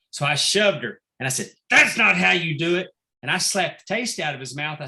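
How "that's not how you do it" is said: loud, higher mock scolding; retold dressing-down. Manager correcting his daughter at ringside, loud retold scolding. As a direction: raise your voice as if telling her off. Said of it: "dressing her down".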